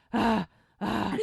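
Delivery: loud imitated panting; acted out-of-breath gasps; heavy breathing. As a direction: huff and puff as if sawing hard. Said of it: "she's heavy breathing".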